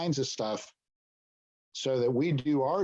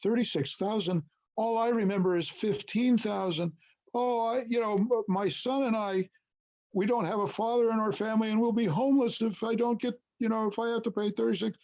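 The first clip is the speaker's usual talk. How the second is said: much higher mock pleading woman's voice; imitating pleading client; 9 semitones higher than usual. Woman at closing table pleading over the fee, in a mock higher voice. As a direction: play the tearful client begging to be spared the fee. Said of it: "She said it with a Russian accent, which I can't imitate".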